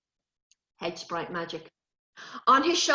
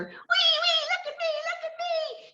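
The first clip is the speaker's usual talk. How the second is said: put-on much higher, gleeful child on a swing; excited whee. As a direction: squeal with joy as you fly up.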